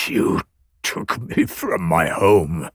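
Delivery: low, halting accusation with a tremble; trembling voice. A narrator's reading. Cursing the priestess who took him from home, trembling voice. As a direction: force the words out haltingly, low. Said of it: "his voice trembling".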